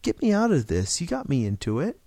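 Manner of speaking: desperate plea; gasped. A narrator's reading. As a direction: blurt it out, high and hurried at the end. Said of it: "he gasped".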